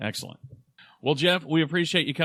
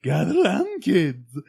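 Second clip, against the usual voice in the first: loud, high, manic summons; hammed-up high call to kids; Mad Hatter; 4 semitones higher, 6 dB louder, slower than usual. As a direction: shout it like a crazed storybook figure. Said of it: "That's like the freaking Mad Hatter".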